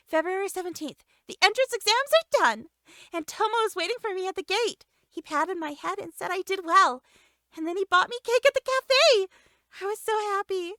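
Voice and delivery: put-on girl reading her diary aloud, giddy; happy diary girl. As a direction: read it like a giddy schoolgirl.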